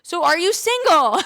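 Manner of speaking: high, loud and drawn-out mock disappointment; mock-dramatic flirt. To Chris after losing the speaker, high loud hammed-up flirting. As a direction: ham up the loss, then ask him out. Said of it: "And I'm like".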